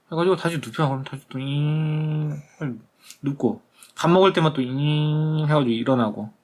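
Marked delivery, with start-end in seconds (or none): 1.4-2.4 long, steady, softer motor whine; motor hum. Retold or imitated. Imitates bed motor lowering him. 4.6-5.5 held whirring note like a bed motor; motor hum. Retold or imitated. Imitates bed motor at mealtime.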